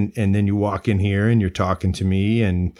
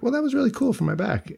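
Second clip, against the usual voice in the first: excited; 14 semitones higher, 3 dB quieter, faster than usual. Retold or imitated. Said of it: "I was like".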